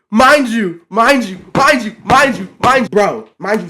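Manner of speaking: loud, high, insistent repetition; exasperated outburst. Real, not acted. Telling how a friend betrayed them, exasperated outburst. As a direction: burst out in disbelief, hammering the phrase.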